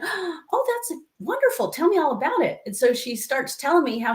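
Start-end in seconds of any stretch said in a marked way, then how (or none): 0.0-2.6 high, gushing mock-delighted praise; gasp, then coo with delight at the child's news; high-pitched encouraging parent; delighted mom. Mother greets child's excited news.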